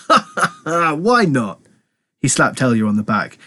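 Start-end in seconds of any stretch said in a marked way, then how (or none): 0.1-1.6 high hearty acted laugh into the words. A narrator's reading. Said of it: "Then he laughed and said".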